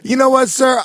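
loud, high, hammed-up address; mock grateful customer. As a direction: announce it to the clerk grandly. Acting grateful customer in clerk's fantasy.

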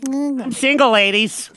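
mock flirt. Host jokingly advertising himself to ladies. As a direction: show off like a cocky bachelor.

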